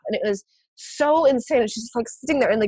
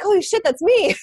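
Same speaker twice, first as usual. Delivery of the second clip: high, stunned exclamation; the penny drops, aghast; shocked realization; 10 semitones higher, 3 dB louder than usual. Retold or imitated. Realizing the screaming is her own, shocked and high. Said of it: "I was like".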